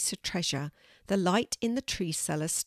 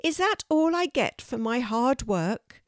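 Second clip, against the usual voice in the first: put-on indignant girl; 6 semitones higher, 4 dB louder than usual. A narrator's reading. Disappointed Kelty.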